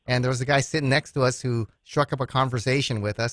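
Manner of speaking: normal talking voice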